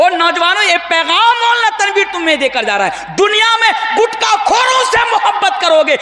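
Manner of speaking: emphatic list building to a high peak; fiery preaching. Real, not acted. Preacher warning youths against loving gutka promoters, fiery preaching. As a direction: hammer each item, then climb into a ringing warning.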